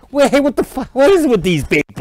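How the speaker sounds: an annoyed star getting his face grabbed; Robert De Niro. Retold or imitated. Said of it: "He goes".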